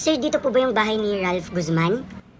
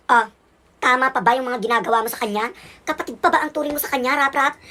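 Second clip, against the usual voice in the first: loud, reproachful mother; raised-voice reproach; angry scolding; 6 dB louder than usual. Acted. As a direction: tell off the older child firmly. Mother scolding older son.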